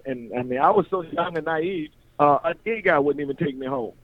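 ordinary talk, nothing marked

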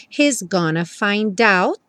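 slow, "find out" joined; linked find out. Shown as a demonstration. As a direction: run "find" into "out". ("find out" ligado, devagar)

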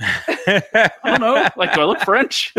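high, laughing mock protest; exasperated comeback. Friend defending himself to the yelling driver, exasperated. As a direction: draw out the helpless retort, half laughing.

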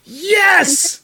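high, loud, elated shout; thrilled cheer. Real, not acted. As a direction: shout it out in triumph. Just knighted by the queen, celebrates.

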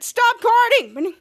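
"guarding" drawn out high and loud; mock quarrel shout. [name] scolding Jasper in laser tag, loud drawn-out complaint.

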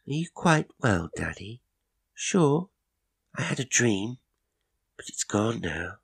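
put-on high child voice, anxious then calm; a little girl checking on daddy; sleepy child. A narrator's reading.

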